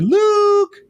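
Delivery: high, loud call into the distance; imitating aunt and uncle calling out; calling for Luke. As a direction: shout his name across the desert. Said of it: "standing out there going".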